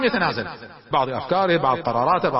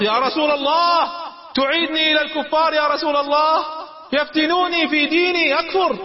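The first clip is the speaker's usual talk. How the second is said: much higher, louder, crying out to be saved; desperate screaming plea; 11 semitones higher, 3 dB louder than usual. Retold or imitated. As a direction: scream it like a man dragged back to captivity. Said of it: "بدأ يصرخ".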